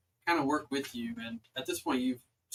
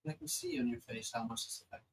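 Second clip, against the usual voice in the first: lightly voiced celestial being, softer and paused; a gently understated goddess, with long pauses between phrases; 9 semitones higher, 9 dB quieter than usual.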